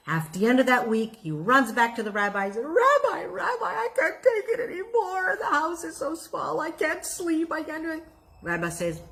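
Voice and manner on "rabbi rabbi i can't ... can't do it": high, wailing plea of a worn-out man; desperate whine. Acted. Man begging the rabbi for help, high desperate wail. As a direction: whimper it like you're at the end of your rope.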